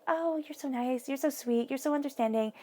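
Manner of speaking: lightly mocking gush of praise; gushing mock sweetness. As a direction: put on a high-pitched fawning voice. Others gush over Cancer's sweetness.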